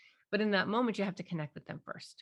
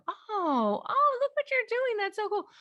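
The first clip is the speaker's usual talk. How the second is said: much higher and louder, delighted gushing; joyful; 6 dB louder than usual. Shown as a demonstration. Parent matching a joyful child, gushing high and loud. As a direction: gush over it with big joy. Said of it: "you wanna be joyful with them".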